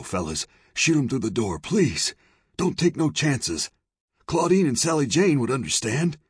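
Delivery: high, wheedling character plea; pleading saloon owner. Acted. Saloon owner begs lawmen to shoot anyway, pleading. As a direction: beg them, pitch climbing high.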